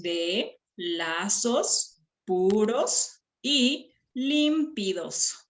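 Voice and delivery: "de lazos puros y límpidos" very slow, syllable by syllable; slow segmented verse with pauses between syllables; counting syllables. Shown as a demonstration. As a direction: separate and stretch each syllable for counting.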